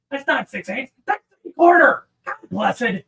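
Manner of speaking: rising, loud self-correction; exasperated. Real, not acted. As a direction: correct yourself in comic exasperation. Exasperated at own misreading.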